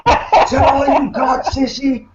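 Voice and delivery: slow, high barked drill sergeant yell; drill instructor voice. Retold or imitated. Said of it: "yelling at him in a drill instructor voice".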